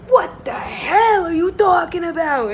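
loud, high mock yell, hell hammed up; imitating Pauly yelling. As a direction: bellow it like a reality TV fight. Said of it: "he's, like, yelling at Angelina".

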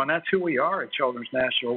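normal talking voice

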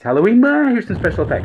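high, loud, fast Halloween yell; loud spooky shout. Pretend. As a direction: burst out loudly for the effect. Special effects shout.

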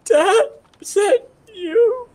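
very high, hammed-up little kid voice; mock tearful child. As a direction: squeak it like a lost child calling Dad. Plays a lost child calling Dad.

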